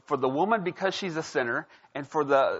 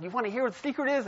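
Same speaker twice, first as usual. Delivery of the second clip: coaxing teacher; 9 semitones higher, much faster than usual. Retold or imitated. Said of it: "she's like".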